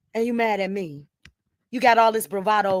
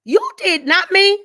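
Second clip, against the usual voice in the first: high, loud finger-pointing; forceful, raised-voice denial; angry accusation; 9 semitones higher, 8 dB louder than usual. Real, not acted. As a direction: throw the blame back loudly. Accusing the other person.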